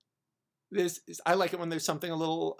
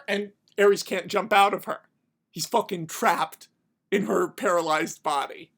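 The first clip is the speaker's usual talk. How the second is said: ordinary talk, nothing marked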